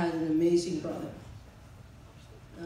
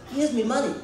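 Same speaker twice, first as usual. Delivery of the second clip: higher, louder imitated Italian shopkeeper; Italian accent; 6 semitones higher, 7 dB louder, faster than usual. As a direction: imitate an indignant-sounding Italian accent, pitched up. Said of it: "he says in Italian".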